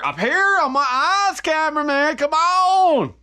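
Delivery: high, loud call to the cameraman. Real, not acted. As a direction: yell up to the camera operator, rising. Calling out to the cameraman.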